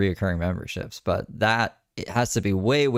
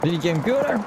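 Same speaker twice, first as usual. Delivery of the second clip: silly voice; 9 semitones higher, faster than usual. Pretend. Silly put-on line.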